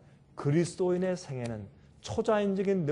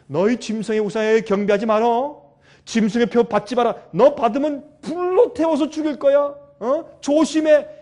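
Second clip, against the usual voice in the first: loud warning that climbs higher at its end; shouted warning; 4 semitones higher, 8 dB louder than usual. Retold or imitated. God shouting a warning not to take the mark. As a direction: bellow the warning like a stern parent. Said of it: "이렇게 소리, 소리 질러요".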